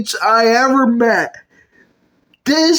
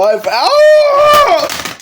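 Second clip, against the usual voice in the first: sharp high yelp of pain as a box falls on her head; 14 semitones higher, 3 dB louder than usual. Acted. Woman yelps high when a box falls on her head. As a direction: cry out high and sudden when hit.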